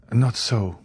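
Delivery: usual speaking voice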